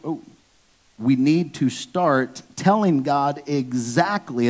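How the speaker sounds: ordinary talk, nothing marked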